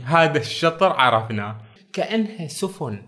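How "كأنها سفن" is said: ordinary talk, nothing marked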